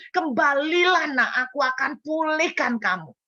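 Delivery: fervent preached plea; impassioned call. Retold or imitated. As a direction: cry it out from the pulpit. God's call, preached climax.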